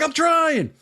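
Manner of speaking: high exasperated protest; frustrated mock yell. Son struggling to pull the nail, high frustrated yell. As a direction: protest back with the pitch way up.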